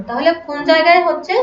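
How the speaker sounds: normal talking voice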